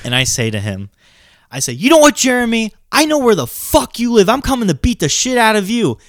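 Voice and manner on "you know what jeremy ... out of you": high, heated phone threat, re-enacted; worked-up, higher-pitched tirade; retold angry threat. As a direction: act out threatening the prank caller. Re-enacts his angry threat back.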